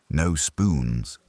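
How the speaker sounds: usual speaking voice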